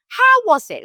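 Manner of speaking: high, loud, flamboyant mother; imitating a flamboyant, excitable mother. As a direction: ask it with a big theatrical flourish. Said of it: "so flamboyant".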